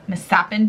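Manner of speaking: "mishappen" said as mis-HAPPEN; misread misshapen. Shown as a demonstration. Owning up to a reading mistake, "mishappen" said as mis-happen. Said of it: "in my head, it was mishappen".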